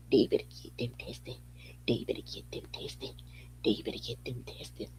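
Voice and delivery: breathy mocking whisper; teasing repeated whisper. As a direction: whisper it over and over.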